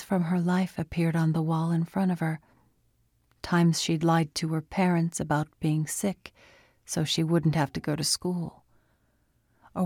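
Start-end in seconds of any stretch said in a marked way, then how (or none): none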